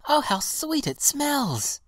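put-on very high, drawn-out child's delight; little girl in high-pitched wonder; delighted cry. A narrator's reading. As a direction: exclaim with rapture, pitched right up. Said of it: "cried the little girl".

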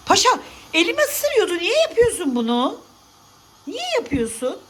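high, whiny pet-talk protest; high-pitched mock scolding; complaining to a pet. Real, not acted. Whining at pet that bit her.

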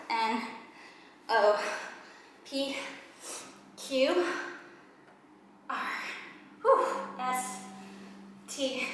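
ordinary talk, nothing marked

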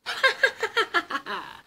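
high gleeful giggle; a little triumphant laugh; mouse giggle. Acted. Mouse laughs at the fleeing owl.

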